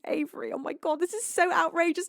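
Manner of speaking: usual speaking voice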